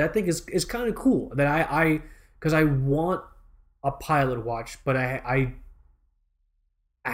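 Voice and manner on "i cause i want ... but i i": ordinary talk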